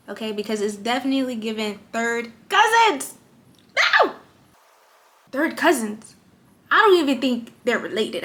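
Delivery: half-laughing loud complaint, with a sudden shouted No; loud exasperated rant. Real, not acted. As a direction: vent loudly about eyebrows that won't match. Ranting about mismatched eyebrows.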